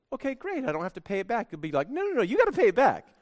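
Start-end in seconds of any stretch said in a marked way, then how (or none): 1.9-3.1 high, fast insistent protest; mock insistent. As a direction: protest quickly, pitch up high. Victim insists the money comes back.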